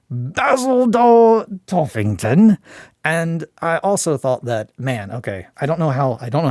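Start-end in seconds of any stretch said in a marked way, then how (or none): 0.1-2.6 high, slow, loud announcer-style naming; booming ceremonial title; grand proclamation. Pretend. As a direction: proclaim the name like a herald. Grand self-address naming the character.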